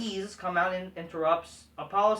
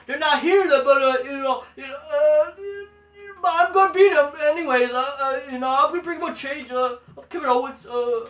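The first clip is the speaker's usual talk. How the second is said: mocking, stumbling promo voice; higher, halting mock of a botched promo; Kevin Owens; 4 semitones higher, 5 dB louder than usual. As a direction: imitate someone struggling to talk, full of uhs. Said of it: "literally, that's how he sounded".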